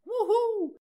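very high, loud falling wuhuu cheer; whoop. Acted. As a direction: cheer like a fan at a concert. Cheer after each patron thanked.

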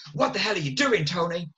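a hint of a barking director; mock director yell. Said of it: "He's like".

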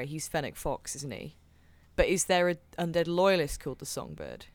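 ordinary delivery

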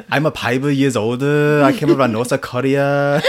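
hammed-up Korean accent for a laugh; mock Korean accent. Joking about a childhood love of accents, mock Korean accent. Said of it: "I used to love accents".

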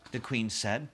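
ordinary delivery

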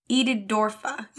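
ordinary talk, nothing marked

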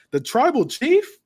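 high, slow sneering question; mocking sneer. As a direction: drag it out high and scornful. Heel mocking the crowd.

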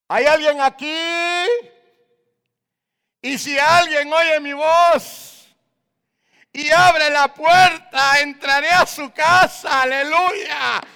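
loud, high, slow shouted preaching; preaching at full force. Acted. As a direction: thunder the invitation to the congregation.

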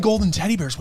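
normal talking voice

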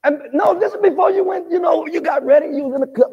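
ordinary talk

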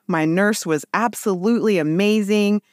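ordinary delivery

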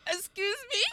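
high, indignant excuse me; shocked. Retold or imitated. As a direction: snap it back in disbelief. Shocked retort.

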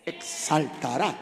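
ordinary talk

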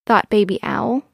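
ordinary delivery

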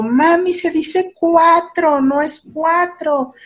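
put-on high, slow, delighted little boy; imitating her son. Mother voicing her son telling her how cuatro is said, high and slow. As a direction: play the excited child announcing his discovery.

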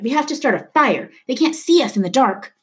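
high-pitched urgent plea; urgent cry. A narrator's reading. Leto pleading to be seen by the ship, crying out. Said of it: "Leto cried".

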